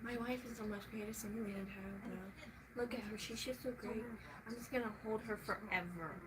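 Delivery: lightly voiced doting husband, soft; a hint of a besotted husband gushing; mock husband gushing about his wife. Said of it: "when you see husbands and they're just like".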